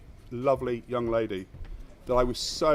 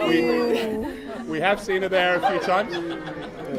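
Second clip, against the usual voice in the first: laughing; 5 semitones higher, 4 dB louder, much slower than usual. Real, not acted. Laughing through the line.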